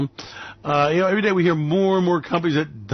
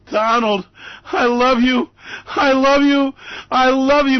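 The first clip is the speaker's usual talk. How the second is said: louder, higher gushing love; mock adoration; 6 semitones higher, 4 dB louder than usual. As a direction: swoon harder with each repeat. Gushing over Donald.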